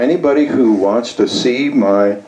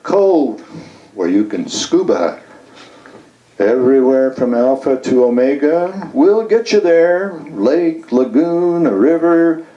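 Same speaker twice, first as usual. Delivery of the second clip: higher, lilting list with rising ends; poem reading; 4 semitones higher than usual. Acted. Poet reciting a travel service sonnet to an audience, lilting. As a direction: recite it like a singsong travel pitch.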